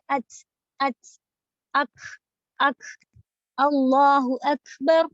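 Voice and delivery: اچ with a puff of breath after the ت; اکھ and اکبر with aspirated breathy ک release. Shown as a demonstration. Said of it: "اس طرح سے کہا جاتا ہے".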